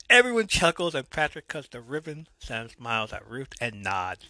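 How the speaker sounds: usual speaking voice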